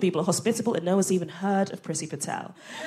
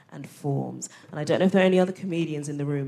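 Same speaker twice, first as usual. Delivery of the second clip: ordinary talk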